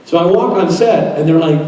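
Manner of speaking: ordinary talk, nothing marked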